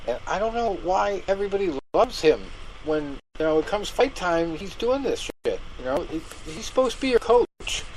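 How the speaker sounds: worked-up griping, voice riding high; exasperated rant. Real, not acted. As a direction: rant in disbelief at a useless coach. Exasperated rant about the coach.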